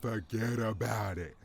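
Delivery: lightly voiced New Jersey wiseguy brushing it off; a hint of a gruff New Jersey mobster; mock New Jersey dismissal; New Jersey tough guy. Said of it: "an angry fat man from Jersey".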